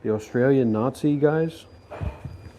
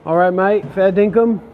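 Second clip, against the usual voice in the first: loud hammed-up Aussie accent, a bit higher; mock Australian; 4 semitones higher, 6 dB louder than usual. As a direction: play the ocker bloke greeting his mate in broad Australian. Mock Aussie greeting to locals.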